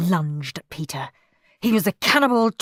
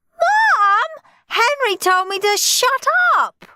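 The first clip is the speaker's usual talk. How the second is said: very high, loud tattletale wail; 6 dB louder than usual. A narrator's reading. Peter telling on Henry to Mum, wailing. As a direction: wail it out like a small child. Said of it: "wailed Peter".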